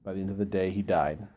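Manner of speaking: ordinary talk, nothing marked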